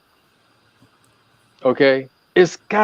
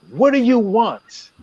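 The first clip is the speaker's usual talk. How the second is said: "What do you want" drawn out slowly and higher, "what" hit hardest; slow emphatic question; 8 semitones higher, slower than usual. Shown as a demonstration. Relationship advisor repeating his key question, slow and emphatic. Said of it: "I can't stress that enough".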